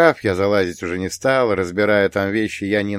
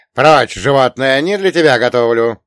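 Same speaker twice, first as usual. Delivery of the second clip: loud, higher vendor's shout; сердитый окрик; 5 semitones higher, 10 dB louder than usual. A narrator's reading. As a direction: shoo the animal away at full voice. Street vendor drives the dog away. (громкий сердитый окрик торговца)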